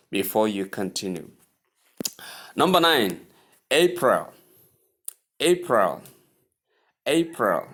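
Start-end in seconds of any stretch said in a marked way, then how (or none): 3.7-7.7 April as A-pril, two syllables. Shown as a demonstration. Teacher modelling April for repetition. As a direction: clip April to two beats. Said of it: "Can you see that".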